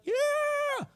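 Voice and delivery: high whooping cheer; cheering crowd. Acted. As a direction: cheer like a pumped-up army. Israel cheering in story.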